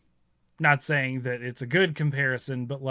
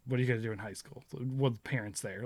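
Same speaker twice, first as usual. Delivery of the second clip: usual speaking voice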